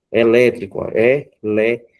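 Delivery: "Elétrico", then "é, lé" split out firmly; single out "lé" as the strong syllable of "Elétrico"; stressed syllable shown. Shown as a demonstration. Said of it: "é mais forte". ("Elétrico", destacando "lé" como sílaba forte)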